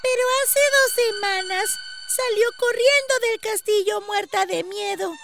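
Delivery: put-on high, loud child voice; a small girl eagerly passing on news; high-pitched village child; little girl. A narrator's reading. Little girl continues her tale.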